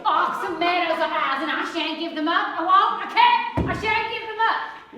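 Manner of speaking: high, loud, rising shout; shrill shouting. Acted. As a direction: screech the refusal. Shrieking refusal in the quarrel.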